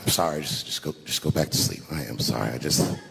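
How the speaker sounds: backpedal, quiet and embarrassed; low sheepish mumble; sheepish apology. Retold or imitated. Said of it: "like, dumb".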